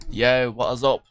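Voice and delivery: playful British-accented greeting. Real, not acted. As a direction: throw out a casual hello in a British accent. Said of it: "doing this in British accent".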